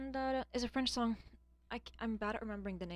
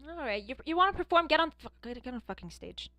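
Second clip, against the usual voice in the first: loud, higher mock taunt; mock challenge; 4 semitones higher, 7 dB louder than usual. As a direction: call them out to perform. (daring coworkers to get on stage)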